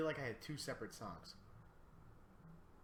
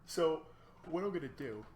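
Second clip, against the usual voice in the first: mid-yawn words; talking through a yawn; 6 semitones higher, 7 dB louder than usual. Real, not acted. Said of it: "God, I can't stop yawning".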